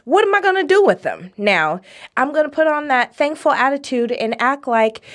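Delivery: ordinary delivery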